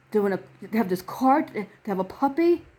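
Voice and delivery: ordinary delivery, nothing marked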